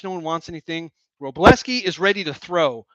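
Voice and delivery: ordinary talk, nothing marked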